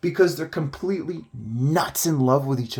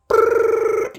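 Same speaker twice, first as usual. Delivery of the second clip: loud rolled "brrrr"; fast buzzing noise for scrolling; imitated rapid trill; 6 dB louder than usual. Don selecting all his windows, loud trilled noise. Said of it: "he's like".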